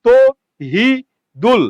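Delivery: তো, হি, দুল as three isolated syllables; slow teaching split of তো হি দুল. Shown as a demonstration. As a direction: break the name into তো, pause, হি, pause, দুল. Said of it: "আপনি কি বলেন?".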